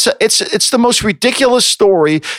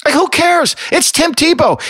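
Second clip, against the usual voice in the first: much higher, exasperated outburst; exasperated rant; 12 semitones higher than usual. Real, not acted. Ranting to his co-host about Tebow, exasperated outburst. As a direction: throw the words up in disbelief.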